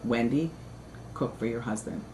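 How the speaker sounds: hint of a grave father on the speakerphone; stern father. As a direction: quietly voice her dad's flat order. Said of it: "My father says".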